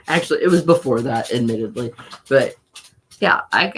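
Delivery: ordinary talk, nothing marked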